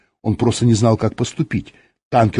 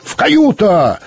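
high, insistent command; menacing shout; 9 semitones higher, 3 dB louder, slower than usual. A narrator's reading. Captain orders the passenger to his cabin, menacing shout. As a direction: cry the order out sternly. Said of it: "крикнул он грозно".

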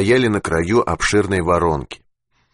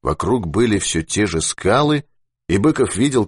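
ordinary delivery, nothing marked

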